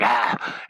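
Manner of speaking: low, heavy breath right into the mic; loud breathing demo. Shown as a demonstration. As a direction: exhale noisily on the microphone. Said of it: "and you hear me breathing".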